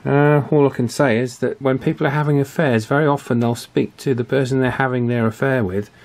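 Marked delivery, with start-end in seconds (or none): none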